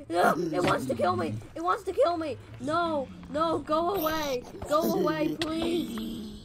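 high, loud cries for mercy; terrified pleading at full volume; panicked shouting. Real, not acted. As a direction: yell as if running for your life. Mob attacks, player flees.

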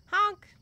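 loud high clown honk; silly clown. Pretend. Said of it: "I'll just give you personal honks".

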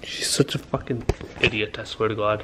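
low, soft half-whisper; exasperated aside. Real, not acted. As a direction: mutter it under your breath. Muttered aside about the dog.

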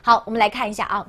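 ordinary talk

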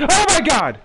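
high, loud sudden fright; startled cry. Real, not acted. Gamer hit by a jump scare, startled cry.

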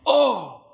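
high, loud yelp of near falling; startled yelp. Retold or imitated. Nearly falling off the board, loud high yelp.

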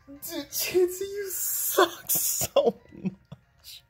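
very high, slow, half-laughing wail; incredulous laugh-wail. Real, not acted. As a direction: wail in disbelief through laughter. Outraged at a critical hit.

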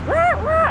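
very high yipping puppy cry; imitated puppy yelp. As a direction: yip like a hurt puppy. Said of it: "she yelped and just".

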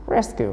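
"Rescue" with RES pitched high; first-syllable stress. Shown as a demonstration. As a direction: punch the start of "Rescue" high. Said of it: "nhớ một".

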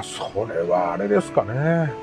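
usual speaking voice

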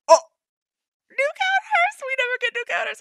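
very high, loud squeal; excited squeal. Real, not acted. Fan spotting rare new counters, excited squeal. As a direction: squeak it out in real disbelief.

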